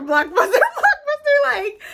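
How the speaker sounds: laughing blockbuster; giggling. Real, not acted. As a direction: burst into giggles. Giddy dreaming of fame.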